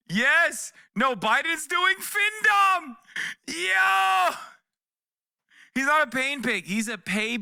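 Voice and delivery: shouting in delight at the idea; excited shout. Real, not acted. Yelling excitedly to chat about Biden.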